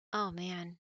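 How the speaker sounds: usual speaking voice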